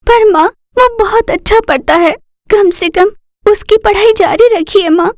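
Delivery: put-on a young girl begging her mother to let her brother study; pleading daughter. Daughter pleading with her mother to keep her brother in school, in a high earnest voice.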